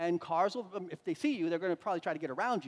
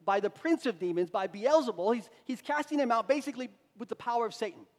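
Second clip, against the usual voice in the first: louder mock accusation from religious leaders; raised, scornful retelling of accusers; imitated ridicule; 4 semitones higher, 7 dB louder than usual. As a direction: jeer it publicly, lightly acted. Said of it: "ridicule him".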